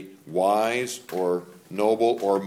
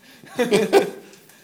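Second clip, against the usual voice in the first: high laugh; 14 semitones higher, 8 dB louder than usual. Real, not acted. Dad laughs at his own story.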